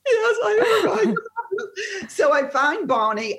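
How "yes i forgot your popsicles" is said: very high wailing confession; hammed-up bawling through the words; mock sobbing. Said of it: "And I start crying".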